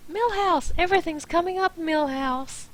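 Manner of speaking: high, loud mock-triumphant cry; exclaimed catchphrase. Reader breaks off to quote a catchphrase, loud and high. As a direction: crow it like a silly victory call.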